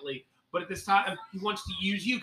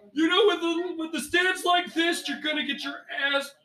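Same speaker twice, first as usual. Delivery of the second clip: Chris Farley impression; 10 semitones higher than usual. DM voicing the instructor as Chris Farley, yelling. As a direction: bellow it like Chris Farley's frantic coach. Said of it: "I'm still Chris Farley".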